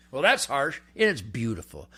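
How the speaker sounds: ordinary talk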